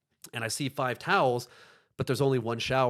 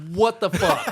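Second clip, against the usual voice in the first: laughing, louder what the fuck; shocked and amused at once; 4 semitones higher, 4 dB louder, slower than usual. Retold or imitated. Gym worker catching two men, laughing shock.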